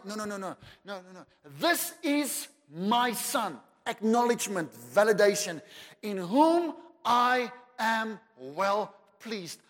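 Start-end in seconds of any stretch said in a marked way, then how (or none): 1.6-3.6 slow, loud, high proclamation; voice of God. Retold or imitated. Father proclaiming over his Son. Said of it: "A voice came and said". 6.0-9.6 slow, loud and high; voice of God. Retold or imitated. Heavenly Father declaring his pleasure. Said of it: "A voice came and said".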